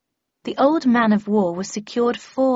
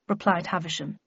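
usual speaking voice

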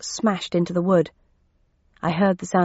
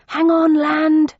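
loud, slow call up the stairs; yelling upstairs; 9 semitones higher, 6 dB louder, slower than usual. Acted. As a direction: shout it up to the next floor. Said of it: "I yelled upstairs".

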